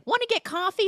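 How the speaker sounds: very high, loud chirp; exaggerated high-pitched eagerness; mock eager invitation. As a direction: pounce on the invitation in a squeaky pitch. Voicing eager invitation to the uncle.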